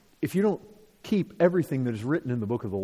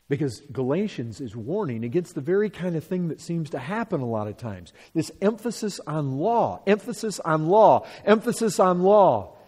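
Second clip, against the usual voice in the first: ordinary delivery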